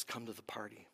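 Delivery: father gently inviting his son; soft invitation. Acted. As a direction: invite him in quietly. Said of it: "the father says".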